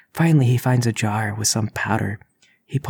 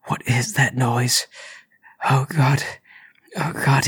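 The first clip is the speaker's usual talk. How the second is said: frightened; 3 semitones higher than usual. Acted. Intruder scared by the noise.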